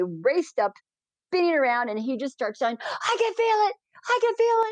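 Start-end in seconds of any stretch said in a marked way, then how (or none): 2.8-4.7 very high-pitched elated cries; excited mock exclamation. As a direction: imitate his amazed delight, way up high. Retells blind man's elated cries.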